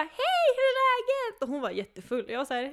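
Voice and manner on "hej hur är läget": put-on loud, much higher drunk greeting; drunk friend. Very drunk friend greeting her, loud and high.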